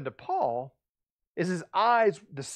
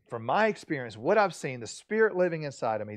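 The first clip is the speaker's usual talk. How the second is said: ordinary talk, nothing marked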